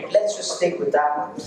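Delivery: ordinary talk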